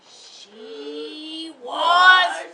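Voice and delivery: big, loud declaration of strength; storyteller booming Emily's strength; dramatic proclamation. A narrator's reading. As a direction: boom it out for the class.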